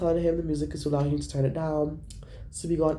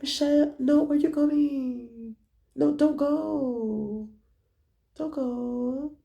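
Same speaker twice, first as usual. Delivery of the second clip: higher, slow, softly pleading; soft pleading friends; 9 semitones higher, much slower than usual. Retold or imitated. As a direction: plead weakly for her to stay. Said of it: "Soft voice".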